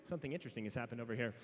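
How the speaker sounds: low, fast, hushed aside; mock intrigue. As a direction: lean in like a nosy narrator.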